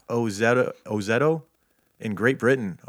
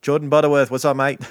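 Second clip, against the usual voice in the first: mock Australian greeting; 4 semitones higher, 7 dB louder, faster than usual. Greeting fan in mock accent.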